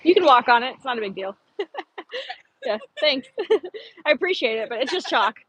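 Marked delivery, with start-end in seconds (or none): 1.6-2.3 laugh. Real, not acted. Laughs off compliment on chalk.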